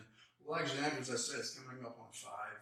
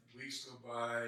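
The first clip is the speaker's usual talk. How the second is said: usual speaking voice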